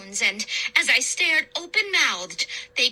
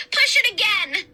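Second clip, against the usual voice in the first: higher, louder urging to push again; urgent cry; 8 semitones higher, 3 dB louder than usual. A narrator's reading. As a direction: command him forward. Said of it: "I cried".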